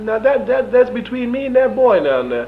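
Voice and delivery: high, loud mock sheriff; imitating Sheriff Tyson. Sheriff defends his quarrel with the prisoner, high loud imitation. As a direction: mimic the sheriff way up high, insisting. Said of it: "He said".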